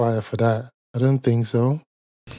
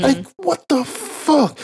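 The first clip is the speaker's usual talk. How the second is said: much higher, slow drawn-out disbelief; stunned disbelief; 13 semitones higher, much slower than usual. Real, not acted. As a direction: stretch it out like you can't believe it. Disbelief at Kelly's answer.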